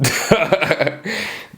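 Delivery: amused burst of laughter; chuckle. Real, not acted. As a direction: laugh at your own joke. Laughs at own teasing line.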